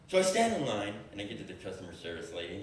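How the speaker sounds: ordinary talk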